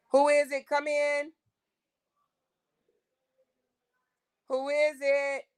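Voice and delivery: high call to the door, voice thrown far. Real, not acted. Asking who is at the door from across the house, high call. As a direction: call it out from another room.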